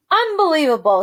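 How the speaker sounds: loud indignant outburst; outraged uncle shouting; shouted. Acted. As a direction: shout it in disbelief. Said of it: "he shouted".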